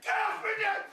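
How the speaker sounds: high, loud cry to be let go; frantic high yell; panicked shout. Retold or imitated. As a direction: shout for your dad to get off. Dog biting his neck.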